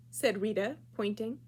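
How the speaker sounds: ordinary delivery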